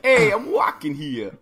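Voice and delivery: put-on loud, high, slow New York accent; hammed-up New York cabbie yell. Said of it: "they're like".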